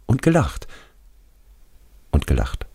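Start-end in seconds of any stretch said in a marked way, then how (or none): 0.1-0.7 hoher, gekränkter Einwurf; ängstlicher toter Schulfreund. Acted. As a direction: als toter Freund den Vorwurf einwerfen, deutlich höher. Radi adds, narrator echoes. (hoch und vorwurfsvoll)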